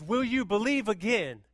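loud, high, urgent cry to the Lord; pleading prayer, loud. Retold or imitated. Pastor voicing the church's prayer, a loud high plea. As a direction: call out to God with everything. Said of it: "It says".

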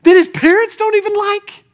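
high, incredulous acted-out thought. Preacher voicing Samuel's thought while anointing David, incredulous. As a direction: question it in high-pitched disbelief.

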